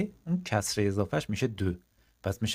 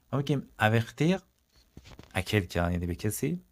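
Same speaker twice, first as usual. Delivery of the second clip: normal talking voice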